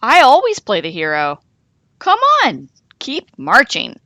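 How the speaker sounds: loud, fast and bossy; loud bossy hero insisting; bossy insistence. A narrator's reading. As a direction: brush them off and push on. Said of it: "Goose wouldn't give in".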